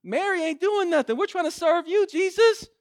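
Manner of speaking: high, whiny complaint; complaining Martha. Retold or imitated. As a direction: Martha griping to Jesus about her sister. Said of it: "And so Martha's like".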